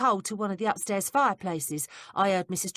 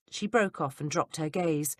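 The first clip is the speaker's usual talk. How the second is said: ordinary delivery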